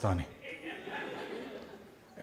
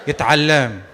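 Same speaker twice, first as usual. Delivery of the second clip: parents' quick plan; 4 semitones lower, much faster than usual. Retold or imitated. Parents voiced in Bahraini Arabic.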